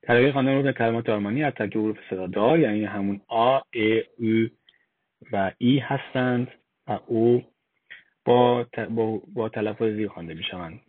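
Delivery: ordinary talk, nothing marked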